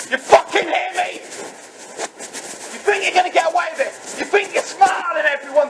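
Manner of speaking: real rage at the man he blames, yelling him down; furious shouting. Real, not acted. Confronting his dad over asbestos, furious shouting. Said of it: "I went in mental".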